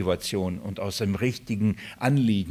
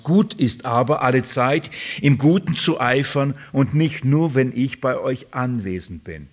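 raised, declamatory verse reading; scripture reading; 3 semitones higher, 5 dB louder than usual. Acted. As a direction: read the verse out emphatically to the congregation. Reads Bible verse aloud.

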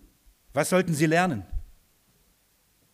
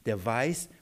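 ordinary talk, nothing marked

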